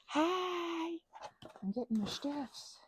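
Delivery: ordinary talk, nothing marked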